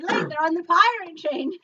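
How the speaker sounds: excited retelling of own cry; excited exclamation. Retold or imitated. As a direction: call it out as a gleeful spot. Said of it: "and I said".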